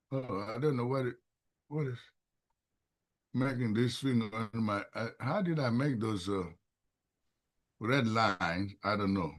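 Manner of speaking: low, very soft musing to oneself; hushed, low-pitched puzzlement; puzzled self-talk. Real, not acted. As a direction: think aloud quietly, half to yourself. Puzzling aloud over screen marks.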